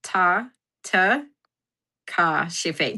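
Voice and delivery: Ta, ta, ka, shife broken into slow soft syllables; sounding it out. Shown as a demonstration. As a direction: spell out the name in pieces, quietly. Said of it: "That's not how you say it".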